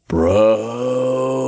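imp's exaggerated aside; loud whisper. A narrator's reading. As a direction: hiss it loudly at a buddy. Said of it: "Todd whispered loudly".

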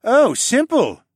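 slow, loud, high sarcastic reply; deadpan sarcasm. A narrator's reading. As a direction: draw it out with mock ease. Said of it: "he deadpanned".

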